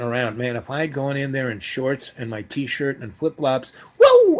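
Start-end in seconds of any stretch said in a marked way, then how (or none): 4.0-4.4 high, loud slipping yelp; sudden high-pitched whoop of losing balance; startled whoa. Retold or imitated. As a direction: cry out as if your feet fly out from under you. Imagining slipping over in blood.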